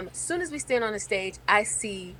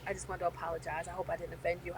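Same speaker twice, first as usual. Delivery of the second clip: much softer, fast sheepish sorry; quiet apology; 4 semitones lower, 12 dB quieter, much faster than usual. Retold or imitated. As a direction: mumble it fast and softly.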